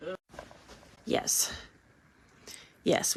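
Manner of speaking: ordinary talk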